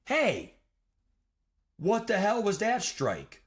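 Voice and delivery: high, loud mock yell at the umpire; mimicking a furious parent yelling from the stands; angry parent yell. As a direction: bark it like an irate baseball dad.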